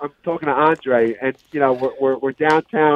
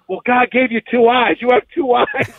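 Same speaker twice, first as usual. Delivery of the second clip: high-pitched, exasperated mock yell; animated retelling of a scolding; retold yell; 10 semitones higher, 3 dB louder than usual. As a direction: imitate a coach yelling back, pitched up. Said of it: "Renzo yells at me".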